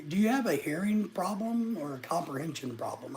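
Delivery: ordinary talk, nothing marked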